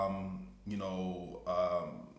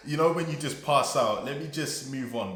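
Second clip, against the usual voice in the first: laughing; 6 semitones higher, 7 dB louder than usual. Real, not acted. Laughing, overwhelmed.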